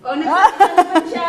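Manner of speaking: sudden loud laughing surprise; surprised laughing shout. Real, not acted. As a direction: burst out amazed. Surprised lola speaks. (gulat na malakas na tawa)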